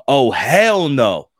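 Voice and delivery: imitating a mom snapping back, loud then firm; a fed-up mother shutting the recruiter down; mom's angry refusal.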